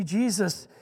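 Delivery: ordinary delivery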